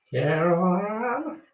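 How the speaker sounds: high, slow, drawn-out calling of the name; creepy southern lady calling. Retold or imitated. Host quoting a film line to her viewers, slow eerie call. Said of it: "It's from a film".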